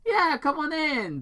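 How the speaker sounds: high, eager insistence; insisting. Acted. Tom waving Robotnik in, high and eager. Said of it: "Tom insisted".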